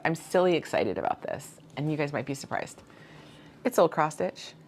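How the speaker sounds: ordinary delivery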